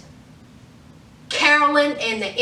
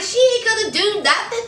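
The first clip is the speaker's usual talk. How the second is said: imitated scoff; 8 semitones higher, faster than usual. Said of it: "He was like".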